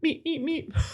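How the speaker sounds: very high, softened beeps; three squeaky mock toots; imitated car horn. Rival driver tooting as he passes, imitated taunting horn. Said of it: "he drove past and went".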